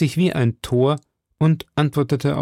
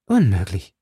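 plain reading voice

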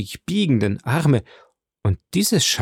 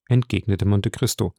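ordinary delivery

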